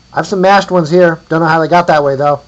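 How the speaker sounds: loud, high shouted offer; calling across distance. A narrator's reading. Offering mashed vertebrae to a stranger, calling out. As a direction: holler it across the hill. Answering the call from afar.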